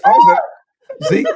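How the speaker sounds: squealed very high, slow through laughter; laughing squeal. Real, not acted. Laughing at Zeke, a very high squeal.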